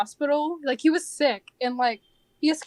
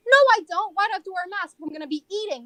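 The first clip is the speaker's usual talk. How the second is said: higher, loud opening mock protest; imitated customer yelling; 6 semitones higher, 4 dB louder, faster than usual. Customer refusing a hostess's mask request, mock yell. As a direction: imitate an indignant customer arguing back. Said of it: "people yell at me".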